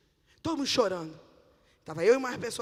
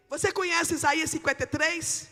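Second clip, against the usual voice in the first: raised, higher-pitched question; sudden forceful retold challenge; bold challenge; 7 semitones higher, 7 dB louder than usual. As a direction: throw the question out like a preacher. Retells challenging the woman with scripture.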